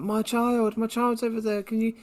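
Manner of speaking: high, pleading mock-mum voice; frantic mum. Podcaster imitating the mum pleading for her child, frantic. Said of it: "where the mum was like".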